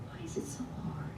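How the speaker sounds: hushed, breathy whisper; whispered question. Real, not acted. Pondering aloud to the congregation, in a whisper. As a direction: drop to a whisper.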